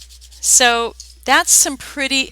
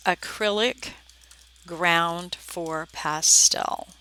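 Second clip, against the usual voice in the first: slow, each word set apart; deliberately slow and distinct; careful enunciation; 2 semitones lower, 4 dB quieter, slower than usual. Shown as a demonstration. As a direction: spell it out slowly, word by word, for clarity. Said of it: "so you can understand what I'm saying".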